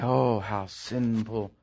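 low, soft, very slow pious tsk; sanctimonious mock gasp; mock disapproval. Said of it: "You say".

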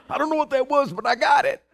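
high, eager dog voice; a pleased dog reporting in, pitched high; proud dog. Retold or imitated. Dog after chasing off the turkey, proud and high. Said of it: "And the dog's like".

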